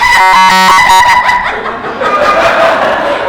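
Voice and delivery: loud, low, hammed-up laugh; over-the-top showman's cackle; dramatic stage laugh. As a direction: laugh hugely for the audience. Demonstrating laugh in dramatic audition.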